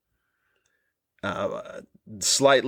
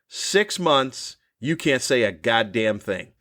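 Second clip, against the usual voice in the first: ordinary talk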